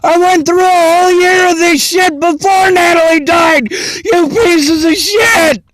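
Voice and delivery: real furious yell breaking into tears; screaming and angry. Real, not acted. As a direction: scream it out through sobs. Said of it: "'cause I'm screaming and angry".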